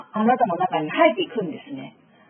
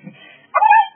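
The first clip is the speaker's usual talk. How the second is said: high, loud warning shriek; mother's scream; 8 dB louder, much slower than usual. Retold or imitated. Mother screaming at her child to stop, loud warning shriek. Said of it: "あなたのお母さんの叫び声".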